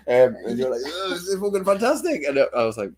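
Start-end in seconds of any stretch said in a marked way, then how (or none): 0.8-2.2 high, gushing mock praise; mock-enthusiastic rave; mimicked delight. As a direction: imitate a friend raving, pitch right up. Mimicking friend's delight at the film.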